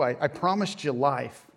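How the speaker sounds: usual speaking voice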